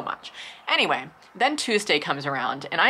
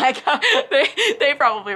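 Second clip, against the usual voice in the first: higher, louder laugh through the words; 11 semitones higher, 6 dB louder than usual. Real, not acted. Telling viewers about being seen sobbing, laughing.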